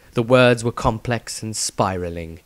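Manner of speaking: ordinary delivery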